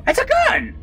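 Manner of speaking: high excited cry. Real, not acted. Player finding a gun cries out excitedly. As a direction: blurt it out thrilled.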